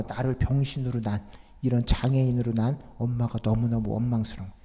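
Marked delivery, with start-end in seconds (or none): none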